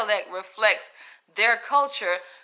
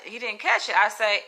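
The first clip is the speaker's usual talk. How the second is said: normal talking voice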